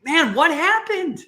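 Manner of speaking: high, slow, loud alarm; concerned surprise. Retold or imitated. Aunt seeing her crying niece, alarmed and slow. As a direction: gasp it out at the hurt kid. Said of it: "That's the first thing anyone's gonna say".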